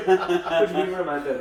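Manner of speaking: laughing disbelief. Retold or imitated. Incredulous retelling, laughing heard.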